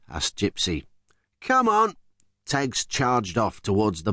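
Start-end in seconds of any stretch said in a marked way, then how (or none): 1.4-2.0 eager call. Acted. Teggs urges the crew.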